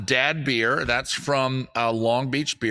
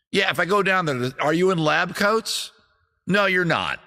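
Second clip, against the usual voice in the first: higher, louder mock outrage; exasperated rant; 9 semitones higher, 3 dB louder than usual. Real, not acted. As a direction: rant at the brewery. Ranting at hipster brewery.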